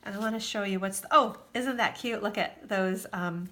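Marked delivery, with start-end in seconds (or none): none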